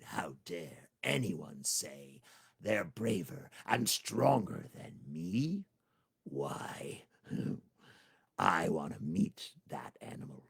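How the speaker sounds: low, slow menacing growl; angry tiger. Acted. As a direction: threaten like an offended big cat.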